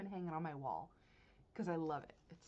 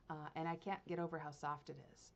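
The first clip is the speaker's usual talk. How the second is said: ordinary delivery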